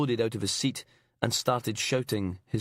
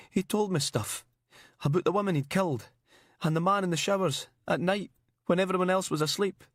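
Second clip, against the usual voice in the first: frantic babble; 8 semitones higher than usual. Acted.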